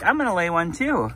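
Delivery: lightly voiced eager chicken; hen voice. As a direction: sound like a competitive little hen. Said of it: "Sunflower's like".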